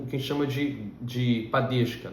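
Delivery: usual speaking voice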